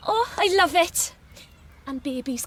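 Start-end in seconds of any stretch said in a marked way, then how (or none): 0.1-1.1 high and loud with delight. Acted. Christine thrilled by Philip's handmade crib, high and loud. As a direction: gush over the gift.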